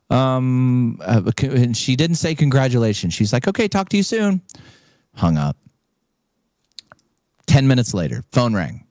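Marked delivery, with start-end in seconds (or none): 3.5-4.4 higher, prim businesslike voice; official caller lady. Retold or imitated. Caller from Aristocrat questions the winner, prim official. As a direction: read it like a formal call-centre script.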